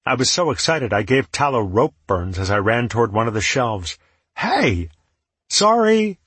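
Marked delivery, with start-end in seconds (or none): 4.4-6.2 high loud apologetic yell. Acted. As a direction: shout it up, sorry but thrilled. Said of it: "I yelled".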